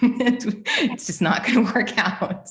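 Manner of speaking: high, loud, laughing through the words. Real, not acted. Laughs at her own grim prediction.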